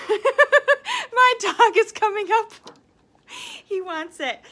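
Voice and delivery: high, loud laugh through the words; laughing at the dog coming up. Real, not acted. Teacher laughing as her dog comes for the cookie.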